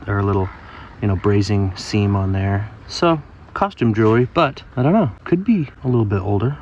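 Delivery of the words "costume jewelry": normal talking voice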